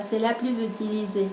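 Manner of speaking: usual speaking voice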